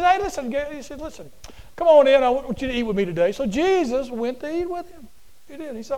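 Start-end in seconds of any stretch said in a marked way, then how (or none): 0.0-3.3 eager host. Acted. Pharisee inviting Jesus to eat.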